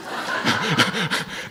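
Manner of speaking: laughing. Real, not acted. Laughing at own demo.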